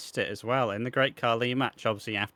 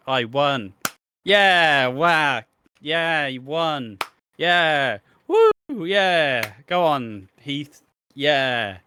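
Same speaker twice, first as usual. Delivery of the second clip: loud, higher whooping cheers; mock celebrating; 4 semitones higher, 8 dB louder, much slower than usual. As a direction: cheer like a giddy winner.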